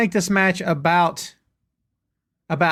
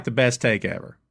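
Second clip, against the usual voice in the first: ordinary delivery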